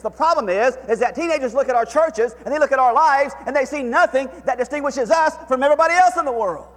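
high, loud preacher's cry to God; impassioned sermon climax; full-force preaching. Acted. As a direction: plead it from the pulpit, full voice.

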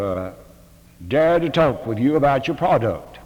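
full-voiced sermon to the faculty; preaching. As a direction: drive it home to the seminary. Preaches with rising force.

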